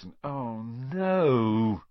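drawn-out, very slow groan of dismay; mock-despairing groan. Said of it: "he groaned".

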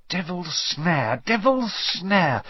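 soft, slow muttering to herself. Acted. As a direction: murmur it while thinking hard. Muttering while trying to remember.

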